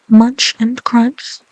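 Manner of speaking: Munch and crunch drawn out slowly. Shown as a demonstration. Relishing the duck's eating, slow. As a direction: savour each word.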